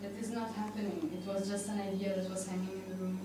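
usual speaking voice

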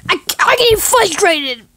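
high, loud, stammered outburst; yelled exasperation; frustrated. Acted. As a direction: burst out as if at the end of your rope.

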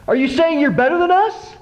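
high, indignant accusation; accusing friend. Retold or imitated. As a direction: throw it at him defensively.

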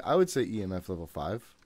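ordinary talk

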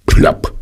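lautes, kurzes Plopp; Korkenploppen. Acted. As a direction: wie ein Korken aus der Flasche. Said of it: "genau wie ein Korken". (lautes Plopp wie ein Korken)

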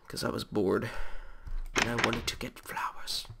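ordinary talk, nothing marked

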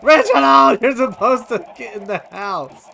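high, loud cry of dismay; loud, high-pitched frustrated yelling; dismayed shouting. Real, not acted. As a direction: wail in exasperation at a pet. Chickens escaping, scolds the fox.

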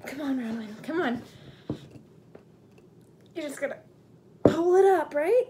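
rising, loud self-encouragement; excited self-coaching. Real, not acted. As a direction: psych yourself up. Coaching herself into handstand.

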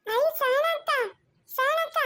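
put-on very high, delighted child's voice; excited little child. Child happily greeting the maid in a very high voice. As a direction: squeal it like a kid spotting a friend.

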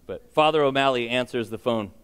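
usual speaking voice

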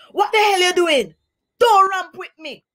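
high, loud, put-on grumbling parrot voice; a cranky bird telling him off; miserable parrot. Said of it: "declared the miserable, unhappy parrot".

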